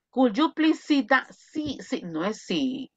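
ordinary talk

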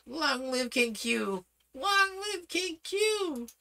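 high, slow rising cheer; shouted cheer. Acted. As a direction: cheer it out like a crowd. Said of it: "shouted all the palace thugs".

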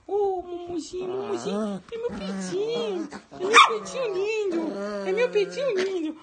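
put-on high cooing baby talk; baby talk to pet. Dad cooing at his pet, high baby talk. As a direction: coo lovingly at the pet.

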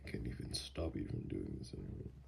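low, soft, half-whispered; hushed surrender; resigned murmur. Acted. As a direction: give in quietly, almost to yourself. Gives in to partner's unbuttoning.

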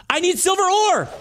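fed-up shout; frustrated outburst. Real, not acted. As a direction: yell it at the screen.

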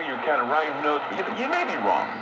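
normal talking voice

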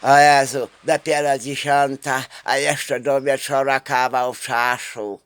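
hammed-up weary lament; mock bored sigh. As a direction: groan it like the day will never end. Mocking bored workers.

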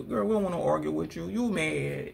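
ordinary delivery

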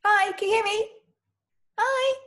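high, loud hellos; flustered greeting. Acted. Nervous woman checking her casting call connects, high loud greeting. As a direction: call brightly down an uncertain connection. Greeting over an uncertain video link.

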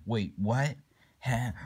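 usual speaking voice

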